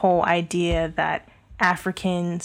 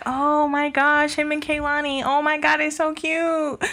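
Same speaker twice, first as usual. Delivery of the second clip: gushing fans; 8 semitones higher than usual. Retold or imitated. Host mocking fans cooing over a couple, gushing. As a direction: imitate swooning fans in a high voice. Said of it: "Everybody was up in arms, like".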